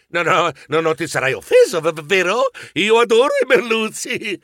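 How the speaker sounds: nervous stammer, rising at the end; mildly stammered appeasing. Acted. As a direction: stutter to calm an angry shark.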